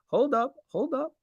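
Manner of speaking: high urgent hold up; real urgency while trading; urgent excited. Real, not acted. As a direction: pitch it up in a hurry.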